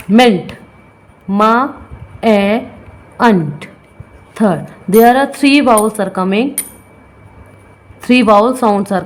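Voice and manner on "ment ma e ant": ment, then ma, e, ant with pauses; sounded out. Shown as a demonstration. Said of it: "second vowel". (ध्वनियाँ तोड़कर उच्चारण)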